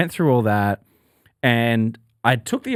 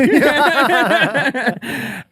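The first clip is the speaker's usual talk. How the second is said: laughing; 10 semitones higher than usual. Retold or imitated. Mock wife voice, laughing.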